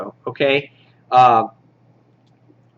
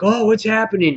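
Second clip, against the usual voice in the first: high-pitched imitated panic; mock freak-out; 9 semitones higher than usual. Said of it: "he's freaking out".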